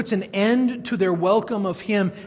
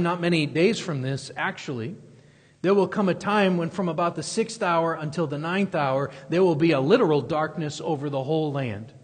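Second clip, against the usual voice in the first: ordinary delivery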